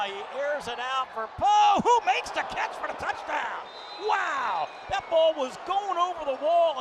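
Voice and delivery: rising excited touchdown call. Real, not acted.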